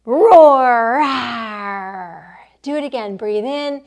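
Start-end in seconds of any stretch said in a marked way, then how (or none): 0.0-2.3 big loud playful lion roar; lion's breath roar. Pretend. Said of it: "Let's do lion's breath".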